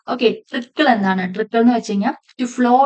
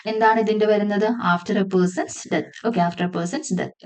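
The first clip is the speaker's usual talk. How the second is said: normal talking voice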